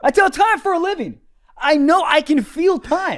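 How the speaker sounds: high-pitched, worked-up insistence; indignant mock boast. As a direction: blurt it in comic outrage. Same boast repeated, now high.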